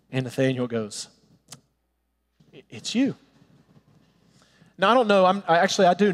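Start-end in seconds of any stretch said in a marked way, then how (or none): 2.5-3.2 stunned. Retold or imitated. Nathanael stunned recognition.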